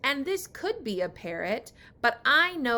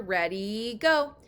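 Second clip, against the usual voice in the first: usual speaking voice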